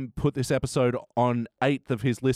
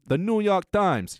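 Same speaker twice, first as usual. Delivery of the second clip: mock New York accent; 6 semitones higher, slower than usual. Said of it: "try it with any publication".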